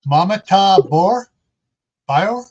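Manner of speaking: sounding out a name slowly with rising, questioning ends; tentative pronunciation. Real, not acted. Host greeting a newcomer by name, tentative pronunciation. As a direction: try an unfamiliar name, unsure. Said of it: "butcher the pronunciation".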